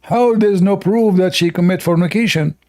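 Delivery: ordinary talk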